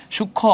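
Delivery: সূক্ষ্ম pitched high as shukkho, kho held; shown pronunciation. Shown as a demonstration. Teacher spells out the k and kh, সূক্ষ্ম as high shukkho. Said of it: "ক আর খ হবে".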